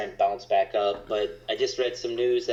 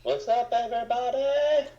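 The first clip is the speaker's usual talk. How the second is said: high, bright call-out to viewers; excited greeting. Real, not acted. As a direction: hype up the stream opening. Stream host greets viewers loudly.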